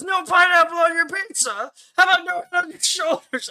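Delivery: high, loud mock outrage; pissed-off Blood Angel. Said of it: "is so pissed".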